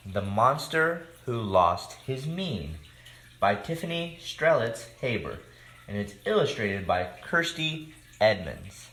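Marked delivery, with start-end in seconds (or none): none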